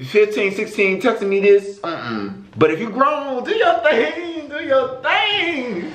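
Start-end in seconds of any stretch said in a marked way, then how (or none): 3.4-5.9 teasing. Real, not acted. Teasing approval.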